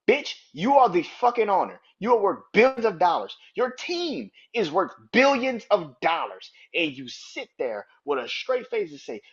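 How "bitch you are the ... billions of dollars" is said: raised, louder outrage aimed at an absent owner; indignant rant. Pretend. Fan berating a team owner over his money excuse, indignant rant. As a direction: chew out the boss in exasperation.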